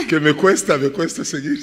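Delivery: loud, talking through real laughter; laughing admission. Real, not acted. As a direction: own up while chuckling heartily. Laughingly admits he cannot follow her. (dicho entre risas)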